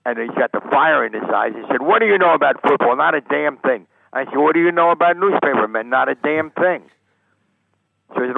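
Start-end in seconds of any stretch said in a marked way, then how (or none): 1.8-3.8 raised, higher, louder demanding voice; retold angry challenge. Lombardi angrily challenges the reporter. 4.4-6.8 higher and louder, mirroring his challenge; retold retort. Reporter fires the question back.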